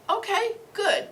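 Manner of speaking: high-pitched, lilting okay; retold approval. Coach moving a student on after her painting, high-pitched approval. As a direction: lift it high as if approving lightly.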